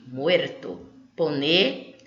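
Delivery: ordinary talk, nothing marked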